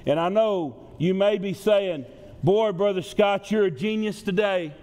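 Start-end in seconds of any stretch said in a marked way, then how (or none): none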